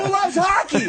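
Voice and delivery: very high-pitched, hollered question; onstage hype call. Retold or imitated. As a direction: whip up the crowd at full pitch. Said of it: "I walk out, I go".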